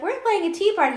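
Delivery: put-on high little-girl voice; imitating a little girl inviting him to tea; girl offering a teacup, high and sweet. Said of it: "and be like".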